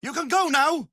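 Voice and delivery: shouted, losing patience; angry shout. Acted. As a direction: bark the order to leave. Wizard dismisses visitor in anger.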